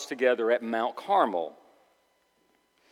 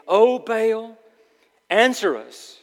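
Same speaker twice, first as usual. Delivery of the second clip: high, raised invocation; calling out; 9 semitones higher, 6 dB louder, slower than usual. Acted. Baal's prophets calling on their god, calling out. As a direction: call up to a distant god.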